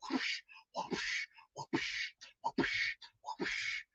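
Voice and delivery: soft voiceless whooshes; whooshing noises. Pretend. Playing cowboy for Tennessee, imitated whooshes. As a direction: mimic a swish with breath alone, evenly spaced.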